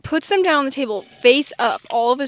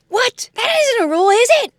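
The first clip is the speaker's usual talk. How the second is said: much higher, louder, disbelieving; mock surprise; 11 semitones higher, 8 dB louder than usual. Dad protesting the Uno rule to the kids, high and indignant. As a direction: act shocked that this is a rule. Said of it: "And he goes".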